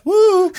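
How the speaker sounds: very high, loud woo; loud comic falsetto hoot; high whoop. Acted. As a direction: break into a silly high whoop. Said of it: "I'll just go".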